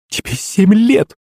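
high, slow, scandalized exclamation; shocked. A narrator's reading. Maman reminds her son of his age, shocked. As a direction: stretch it out in appalled disbelief. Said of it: "охнула маман".

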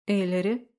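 Эллири with a rising questioning tune; Эллири asked as a prompt. A narrator's reading. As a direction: lift the end of Эллири, inviting an answer. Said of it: "вопросительно произнесла распорядительница".

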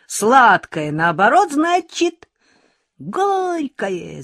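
high, loud joyful chant; the whole class shouting in triumph; gleeful shout. A narrator's reading. Classmates gleefully shouting at Lyolka, loud teasing. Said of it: "закричали все радостно".

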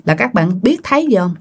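plain reading voice

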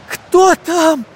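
high, startled call; frightened shout. A narrator's reading. Boy challenges the screaming sack, shouting in fright. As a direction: call out in alarm.

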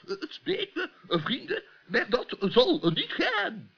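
low, halting professor voice full of ehs; an absent-minded old scholar fumbling for words; low hesitant professor stammering; doddering professor. Acted. Professor voice throughout.

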